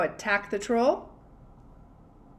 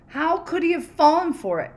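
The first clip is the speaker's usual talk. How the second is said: higher, louder exasperated question; dismayed; 8 semitones higher, 6 dB louder than usual. Acted. Troll kicking himself over the apartment, exasperated. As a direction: throw up your hands in disbelief.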